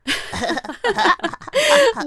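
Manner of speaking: high bright laughing; group laughter. A narrator's reading. Everyone laughs at the girl's performance, laughing. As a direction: laugh at her show.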